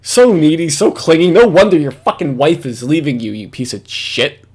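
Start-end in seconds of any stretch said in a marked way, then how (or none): none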